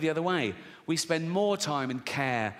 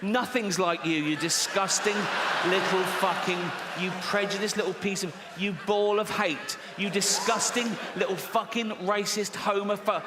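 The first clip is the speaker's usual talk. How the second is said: high, raised-voice mock fury; hammed-up righteous rant running out of words; outraged tirade; 5 semitones higher than usual. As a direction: splutter insults in disgust. Acted outraged tirade at Timmy.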